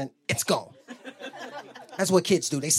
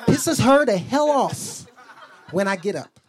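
ordinary delivery

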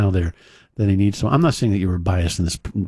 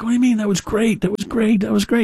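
much higher, mock-incredulous bandmates; protesting bandmates; 12 semitones higher, faster than usual. Retelling the bandmates protesting to Eddie, high mock disbelief. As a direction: squeak out the guys' disbelief.

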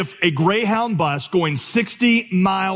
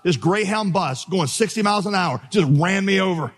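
ordinary talk

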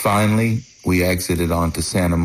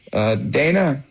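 hesitant, pitched-up call; tentative call; 8 semitones higher than usual. Retold or imitated. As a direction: call his name unsure. Calling into open house.

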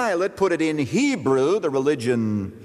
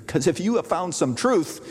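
ordinary talk

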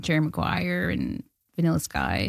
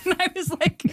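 laughing; 12 semitones higher than usual. Real, not acted. Laughing at the memory.

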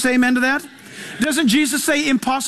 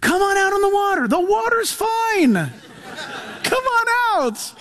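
playful Jesus invitation; 7 semitones higher than usual. Retold or imitated. Preacher voicing Jesus calling Peter onto the water, playful and higher. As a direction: coax him out onto the water with a grin. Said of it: "he'll say something crazy like".

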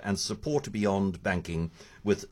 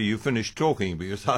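talking through a mouthful; words round a bite of food; mouth full. Real, not acted. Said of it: "With a mouthful".

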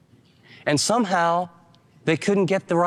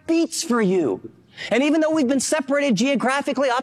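heartfelt Paul; 8 semitones higher than usual. Retold or imitated. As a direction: draw each word out as if confessing love. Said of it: "He's saying".

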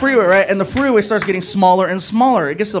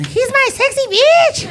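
very high, drawn-out mock wife voice; wife impression; 3 dB louder, slower than usual. Wife claiming her husband, high squealed impression. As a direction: squeal it slowly in falsetto. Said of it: "His wife calls him Sexy".

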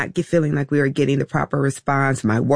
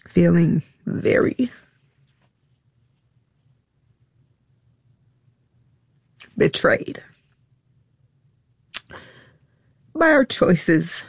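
halting through long pauses, on the edge of tears; choked up; 3 semitones higher than usual. Real, not acted. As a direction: struggle to get each word out. Admitting her child felt betrayed.

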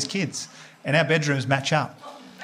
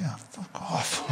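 half-voiced, slow, much softer; barely voiced slow mutter; under the breath; 10 dB quieter, much slower than usual. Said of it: "like that, under my breath".

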